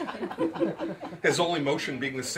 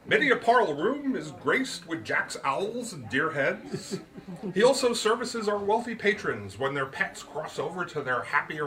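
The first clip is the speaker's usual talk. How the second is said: put-on higher, louder, grand old gentleman; Root's voice; 6 semitones higher, 3 dB louder than usual. A narrator's reading. Root lectures his guest on the magic word, higher and grand. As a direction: pronounce it like a showman, slowing on the magic word.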